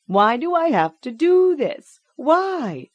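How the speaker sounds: high, loud anguished cry; crying child. A narrator's reading. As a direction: cry out in despair.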